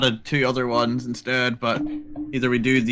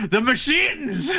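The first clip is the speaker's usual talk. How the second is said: mocking; 10 semitones higher, slower than usual. Mocks husband's excuse.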